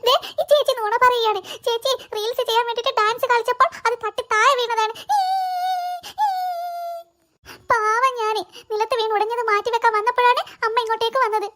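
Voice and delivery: put-on high, childlike whine to mother; little girl voice. Little girl pleading innocence to her mother, high childlike voice. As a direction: play the tiny girl protesting sweetly.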